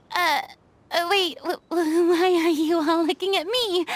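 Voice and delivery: nervous stammering protest; flustered. Acted. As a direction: stumble over the words, put on the spot. Put on the spot to fight.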